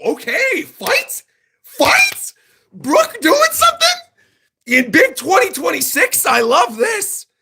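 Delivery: can't contain the excitement, yelling fight; high-pitched hype yell; excited. Real, not acted. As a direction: pitch shoots way up, squealing with hype. Hyped reaction to the fight.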